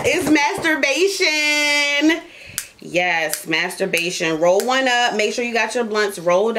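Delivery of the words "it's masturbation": slow, drawn-out high announcement; big reveal. Real, not acted. Host revealing tonight's topic to listeners, slow and grand. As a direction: unveil the topic like a prize. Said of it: "drum roll".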